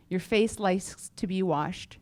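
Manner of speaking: ordinary talk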